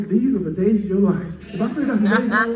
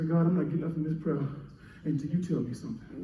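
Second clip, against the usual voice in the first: quiet prayer; 6 semitones lower, 11 dB quieter than usual. Acted.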